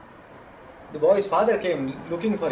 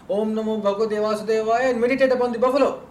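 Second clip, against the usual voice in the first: high, building to a quick finish; raised pitch, hurrying at the end; eager instruction; 5 semitones higher, 5 dB louder, faster than usual. Retold or imitated. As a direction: urge it on with acted enthusiasm. Master eagerly gives new instruction.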